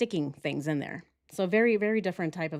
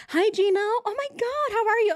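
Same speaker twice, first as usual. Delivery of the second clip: much higher, louder gushing hello; fake bubbly greeting; 13 semitones higher, 4 dB louder than usual. Mocking the suspect calling Gino, gushing fake cheer. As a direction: overdo the sweet phone greeting.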